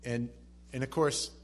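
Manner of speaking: ordinary delivery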